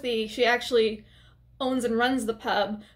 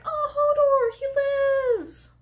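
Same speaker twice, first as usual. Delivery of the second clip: much higher, louder delighted squeal; joyful squeal; 4 dB louder, much slower than usual. Retold or imitated. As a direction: squeal with joy at an old friend. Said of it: "I was so excited".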